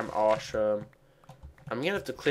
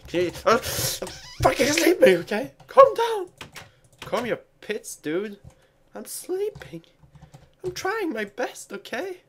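squeaky annoyed falsetto; high whiny voice; 13 semitones higher than usual. Pretend. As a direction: whine back in falsetto. Mock arguing in falsetto.

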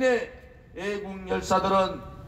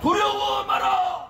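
rally cry, shouted slow and high; roaring rallying speech; 8 semitones higher, slower than usual. Real, not acted. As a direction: roar it to the crowd. Leader chants, crowd echoes.

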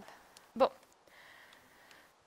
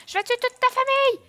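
angry shout; 8 semitones higher, 8 dB louder than usual. Acted. Première menace.

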